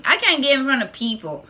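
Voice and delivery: usual speaking voice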